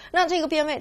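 ordinary talk, nothing marked

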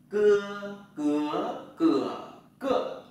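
"cưa" with no puff of air, loud, through four tones; unaspirated cưa drill. Shown as a demonstration. Teacher drilling students on "cưa" without aspiration. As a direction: keep the air in on "cưa" each tone. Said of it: "Nhưng mà đừng có bật hơi".

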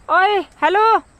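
high, slow loud call. Real, not acted. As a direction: stretch the hello out to the dog. Calling to a dog.

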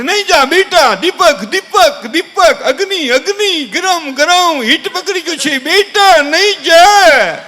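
high, drawn-out alarm from fussing family; mock panicked warning. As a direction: stretch each warning in exaggerated alarm. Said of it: "તરત જ આખું ઘર થોડી". (ગભરાયેલા કુટુંબની લાંબી ઊંચી બૂમો)

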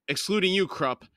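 a touch of a growl on the rebuff; mild growl. A narrator's reading. Cole cuts Krupp out while advancing on him, mild growl. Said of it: "Cole growled".

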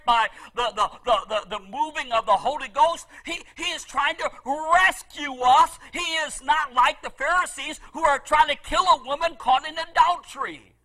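normal talking voice

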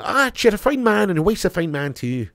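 high-pitched put-on Irish lilt; Irish accent. Streamer showing chat that Irish is better, hammed-up Irish accent. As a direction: go up high into a playful Irish brogue. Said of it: "Irish is better".

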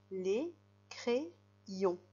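"Les crayons" split into separate syllables. Shown as a demonstration. Teacher breaking a word down for pupils, "Les crayons" syllable by syllable. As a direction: pause between each syllable of "Les crayons". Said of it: "pour ensuite faire la syllabe".

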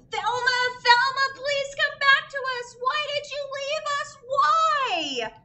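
high, pleading wail of fans; high desperate wail ending in a shout. A narrator's reading. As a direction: cry out for Thelma to come back. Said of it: "her fans did wail and cry".

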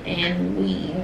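soft, steady grace before meals; prayer. Acted. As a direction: pray over the food quietly. Blesses the food before eating.

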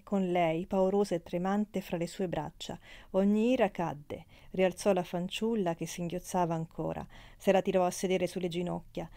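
ordinary delivery, nothing marked

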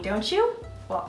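usual speaking voice

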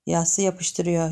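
plain reading voice

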